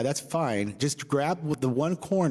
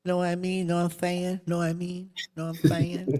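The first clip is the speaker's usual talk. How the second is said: a hint of Mike Tyson, raised pitch; lightly voiced boxer catchphrase; Mike Tyson impression; 7 semitones higher than usual. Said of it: "Like Mike Tyson".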